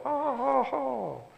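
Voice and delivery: high wailing oh oh oh; queen's moans. A narrator's reading. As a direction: moan high like the fussing queen. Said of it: "gridava la regina".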